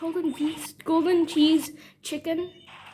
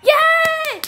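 high, loud shout of joy; excited cheer; 12 semitones higher, 6 dB louder than usual. Real, not acted. Player hoping for golden cheese, excited cheer. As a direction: burst out cheering. Celebrating a pull.

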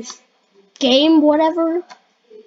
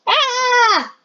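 long high wailing scream; panicked scream; 10 semitones higher than usual. Pretend. Player losing control in the game, panicked scream. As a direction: scream as if flung away.

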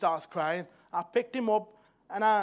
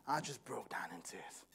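hushed, breathy whisper; tearful whisper; 7 semitones lower, 11 dB quieter than usual. Retold or imitated. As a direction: drop to a whisper as if reliving it. Retelling breaking down at son's birth.

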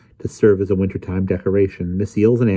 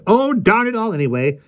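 high-pitched, exasperated grumble; grumbling; 13 semitones higher than usual. A narrator's reading. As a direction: grumble crossly at your own clumsiness. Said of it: "she grumbled".

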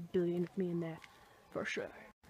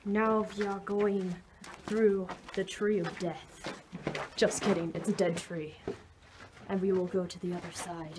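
usual speaking voice

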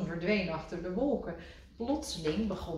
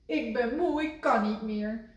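weary moan, higher; groaning; 5 semitones higher, 3 dB louder than usual. A narrator's reading. Kikker lying in grass, mild weary groan. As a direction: groan it out tiredly. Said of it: "steunde hij".

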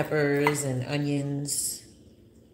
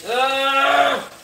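a high, loud exasperated "ugh"; frustrated groan; 10 semitones higher, 7 dB louder than usual. Real, not acted. Groaning at the ruined fish, angry. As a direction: let the frustration burst out. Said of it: "I'm so pissed off right now".

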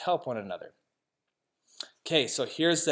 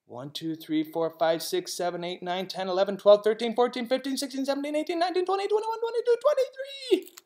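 each number climbing higher; rising count; 8 semitones higher, faster than usual. Shown as a demonstration. Narrator counts twenty-three Daves with the kids, climbing steadily higher.

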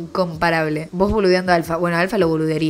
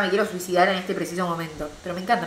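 ordinary delivery

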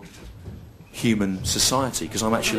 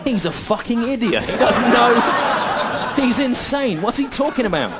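much higher, squeaky mocking heckler voice, laughing; mock audience; 12 semitones higher, 3 dB louder than usual. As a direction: lampoon the sneering crowd. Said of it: "people are going".

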